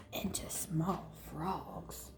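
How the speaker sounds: slow hushed whisper. Acted. Fairy whispers the frog threat to Jaya. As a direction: trail off spookily.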